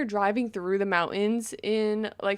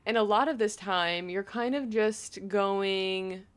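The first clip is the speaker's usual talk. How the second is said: usual speaking voice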